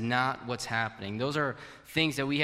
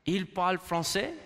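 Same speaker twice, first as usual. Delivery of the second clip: French phrase; 5 semitones higher than usual. Retold or imitated. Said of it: "you would say probably like".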